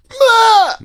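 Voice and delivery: sudden loud, high mock shriek; imitated startled scream. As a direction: shriek as if grabbed, hammed up. Acts Eric startling woman who touched him.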